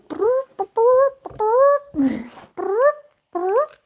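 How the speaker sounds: high, loud rolled cat trills; imitated chirrups. Showing Lucky's chicken noises, high trilled chirrups. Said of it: "I call them chicken noises".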